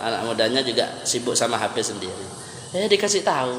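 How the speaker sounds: normal talking voice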